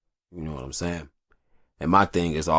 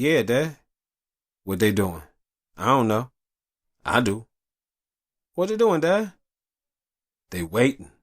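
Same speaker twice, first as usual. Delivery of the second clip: retold father and son; 7 semitones higher, 3 dB louder than usual.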